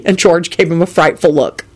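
plain reading voice

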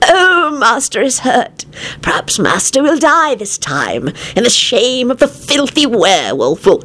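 slow, high, gloating creature voice; happy moan. A narrator's reading. Weezer hoping Master will die, moaning happily. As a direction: drag it out with moaning relish. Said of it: "he moaned happily".

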